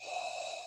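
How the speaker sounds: hoarse throaty exhale through the throat; Darth Vader breath. Shown as a demonstration. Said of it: "Darth Vader speak".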